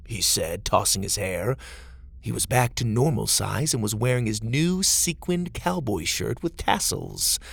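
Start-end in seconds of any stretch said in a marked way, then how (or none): none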